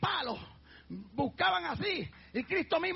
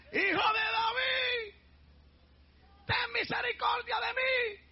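slow, higher tearful shout; crying plea for mercy; 7 semitones higher, slower than usual. Acted. Blind beggar begging Jesus for mercy, tearful higher shout. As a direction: beg him, voice near breaking.

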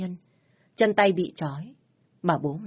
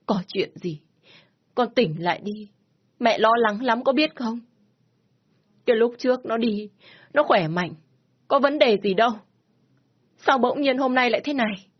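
tearful mother; 5 semitones higher, 3 dB louder than usual. Acted. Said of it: "bà vừa khóc".

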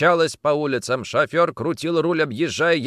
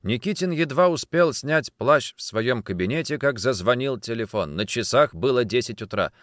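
plain reading voice

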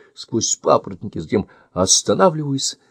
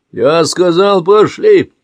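man's barking order, much higher and louder; angry shout; 9 semitones higher, 7 dB louder, slower than usual. Acted. Stranger shouts at the boy to come along, angry shout. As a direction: yell it while dragging him. Man drags boy through woods.

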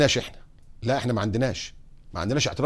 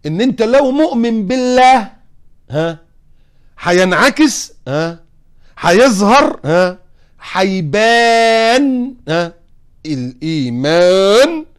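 rising preacher's cry; 6 semitones higher, 8 dB louder, much slower than usual. Acted. Preacher building to climax.

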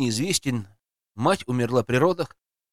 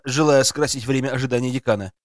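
ordinary delivery, nothing marked

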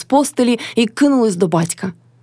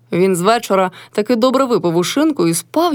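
ordinary delivery, nothing marked

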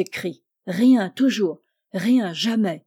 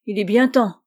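a touch of anger, said louder; angry murmur, louder; 7 dB louder than usual. Acted. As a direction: reproach him for coming so late. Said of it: "murmura Cretel avec colère". (reproche un peu fâché, plus fort)

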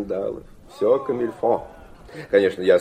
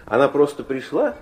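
ordinary delivery

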